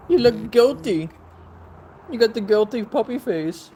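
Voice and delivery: high pet-owner voice. Real, not acted. Owner to a guilty puppy, teasing baby talk.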